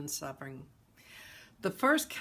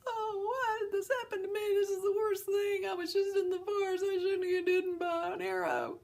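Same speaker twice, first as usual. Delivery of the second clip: high, loud whining complaint; hammed-up self-pitying whine; whiny self-pity; 5 dB louder than usual. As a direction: wail like a spoiled victim. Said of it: "That's like".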